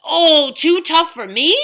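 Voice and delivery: loud, high indignant shout. Acted. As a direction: bluster back at her. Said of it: "shouted Cucullin".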